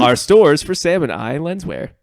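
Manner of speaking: loud, slow mock commercial read; ad announcer. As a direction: pitch it like a cheesy ad. Mock store ad.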